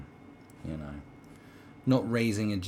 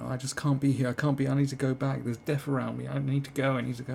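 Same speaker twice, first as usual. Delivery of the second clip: mock crying girl; 6 semitones higher than usual. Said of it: "I remember seeing some girl crying".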